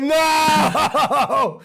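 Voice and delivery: high, drawn-out comic cry of dread; mock horrified wail. Tripper realizing the bad trip begins, mock horrified wail. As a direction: wail it out in hammed-up despair.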